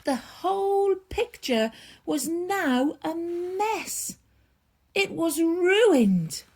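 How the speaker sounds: ordinary delivery, nothing marked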